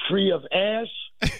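slow, drawn-out preacher cadence; mock civil-rights oration, very slow; Martin Luther King. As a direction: stretch each word like a speech at the monument. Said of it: "I will echo the words that Doctor Martin Luther King said".

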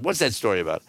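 ordinary talk

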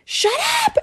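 shrieking high imitation scream; top-of-lungs mock scream. Mom screaming at her husband, very high mock shriek. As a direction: pitch it sky-high like a mom who has snapped. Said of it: "says at the top of her lungs".

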